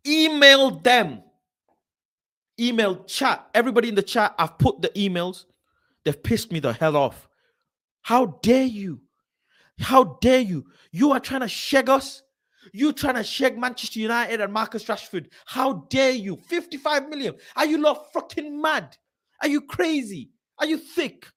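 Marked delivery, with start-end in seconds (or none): 0.1-4.3 yelling at viewers to email them; loud urging. Real, not acted. 8.0-21.3 high, indignant shouting; telling off the website; outraged. Real, not acted. Outrage at the valuation.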